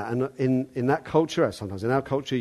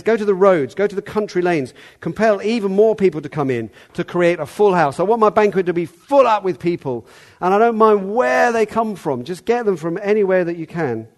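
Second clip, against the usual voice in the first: raised, heated commands to the servant; angry order; 6 semitones higher, 6 dB louder than usual. Retold or imitated. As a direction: send him out in a temper, louder and higher. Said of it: "sends his messenger out in his anger".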